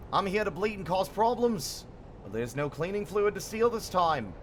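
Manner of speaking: improvised mock Kiwi-ish accent; a wobbly put-on accent that keeps slipping; made-up accent. Streamer greeting Zio in a wobbly put-on accent. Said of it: "I don't know where this accent is going".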